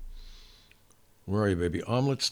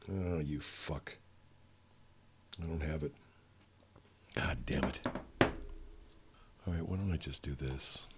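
whispering; 7 semitones lower, 14 dB quieter, slower than usual. Real, not acted. Frustrated under breath.

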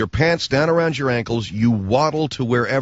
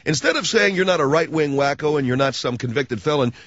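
ordinary talk

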